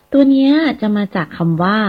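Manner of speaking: ordinary talk, nothing marked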